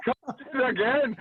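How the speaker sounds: slow, high, relishing "Cunted again"; savouring the word. Shown as a demonstration. Comic savouring the grandma's word for the audience, slow and high. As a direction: draw out "Cunted again" slowly, pitch up. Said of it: "Could you think of a better word?".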